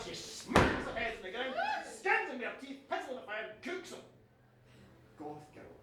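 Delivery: usual speaking voice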